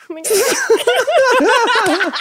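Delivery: high drawn-out fangirl squeal; starstruck gasp. Retold or imitated. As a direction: squeal it slowly with a laugh. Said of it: "and I was like".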